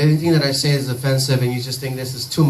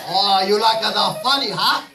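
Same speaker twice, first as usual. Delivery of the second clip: mock Japanese accent; 4 semitones higher, slower than usual. Mocking a Japanese lady.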